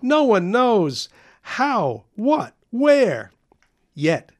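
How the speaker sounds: high and loud, tumbling over himself; a kid raving about the mystery stink; breathless excitement. Acted. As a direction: gush it out, barely containing it. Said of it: "cried Carl".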